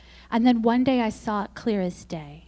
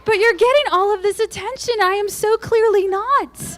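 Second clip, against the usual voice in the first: high, loud, laughing self-mockery; petty jealous rant; 11 semitones higher, 6 dB louder than usual. Confessing jealousy of the blogger, high, loud mock-petty rant. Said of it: "I'm like".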